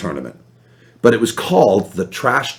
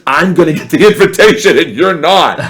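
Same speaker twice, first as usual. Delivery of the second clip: gloating; 5 semitones higher, 5 dB louder than usual. Retold or imitated. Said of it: "I said".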